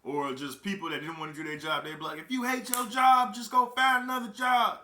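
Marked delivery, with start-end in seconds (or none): 2.2-4.8 high, loud mimicked yell; imitated shouting. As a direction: yell it across the cellblock, mimicking them. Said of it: "That's how they say it".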